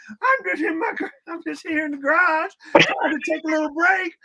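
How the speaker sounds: mock Southern drawl pleading from the garage; Mark's Southern drawl. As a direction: put on a hammed-up Southern drawl, laughing through it. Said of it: "It's like a Southern drawl".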